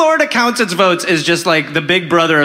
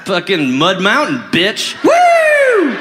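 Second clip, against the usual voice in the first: rowdy shout; 3 semitones higher than usual. Acted. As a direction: whoop like a drunk brother. Rowdy brother.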